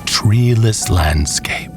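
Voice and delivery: ordinary delivery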